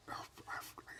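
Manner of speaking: whisper. Real, not acted. Realizes stream still running.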